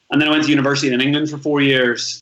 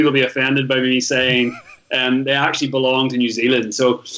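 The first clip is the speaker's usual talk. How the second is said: usual speaking voice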